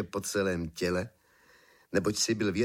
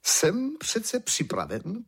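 pompous emperor; 10 semitones higher, much slower than usual. A narrator's reading. Emperor declares himself ready.

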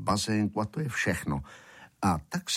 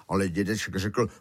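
ordinary delivery, nothing marked

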